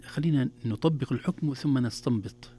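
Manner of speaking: normal talking voice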